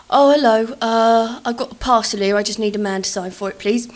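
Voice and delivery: loud, brash fake politeness; booming over-polite prank voice; cheeky loud delivery. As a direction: brother loudly asking for a man to sign. Brother pranks the woman loudly.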